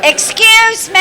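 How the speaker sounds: loud, high call for order; teacher voice. Real, not acted. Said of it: "Get my teacher voice out here".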